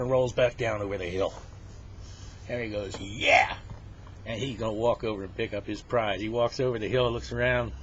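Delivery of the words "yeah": short, loud, breath-heavy cheer; triumphant cheer. Acted. Henry thinks he got his bear, triumphant cheer. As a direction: let out a quick victory cry.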